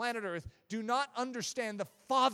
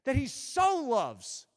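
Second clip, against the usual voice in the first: slow, raised emphasis on "so"; stress on SO; 4 semitones higher, 4 dB louder, slower than usual. Shown as a demonstration. Preacher quoting John three sixteen, stress on SO. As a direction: stretch and lift the word "so". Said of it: "you notice I emphasized so".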